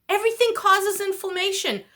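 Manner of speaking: loud, high, exasperated outburst; exasperated rant. Real, not acted. Venting about diet rules, loud and exasperated. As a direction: throw up your hands at the advice.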